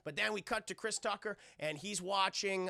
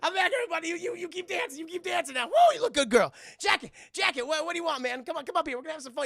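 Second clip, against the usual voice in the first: much higher, louder showman voice; Chris Tucker; 10 semitones higher, 8 dB louder, faster than usual. Retold or imitated. Chris Tucker on stage calling to the crowd, high showman voice. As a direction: work the crowd like a star. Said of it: "And Chris Tucker's like".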